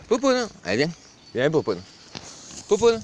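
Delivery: high call to the goat. Real, not acted. Owner calling the goat over, high coaxing call. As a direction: coax her to come.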